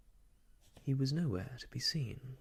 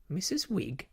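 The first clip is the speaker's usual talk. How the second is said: high, thin, affronted lady; thin-voiced indignant echo; 9 semitones higher than usual. A narrator's reading. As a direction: pitch it high and thin, taken aback. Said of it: "in a voice even thinner than herself".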